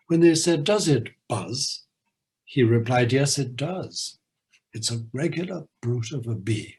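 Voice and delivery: lively poem recitation with a bouncy beat; limerick reading. Acted. Host reading the Norway limerick aloud, lively recitation. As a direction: deliver the nonsense rhyme with relish.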